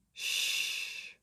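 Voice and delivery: soft unvoiced shh; quiet shushing baby; whispered shush. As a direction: hush the room.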